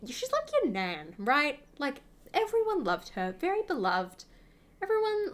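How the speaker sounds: ordinary delivery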